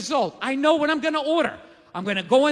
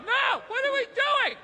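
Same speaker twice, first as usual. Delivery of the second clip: much higher, pleading little inner voice; high in-shape voice; 12 semitones higher, 4 dB quieter than usual. Retold or imitated. His in-shape voice begs him to go to the gym, much higher. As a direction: squeak it like a tiny panicked conscience. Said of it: "my in-shape voice".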